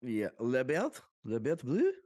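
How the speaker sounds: mock French accent, rising at the end; horrible French accent. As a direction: put on a terrible French accent. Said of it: "this horrible French accent".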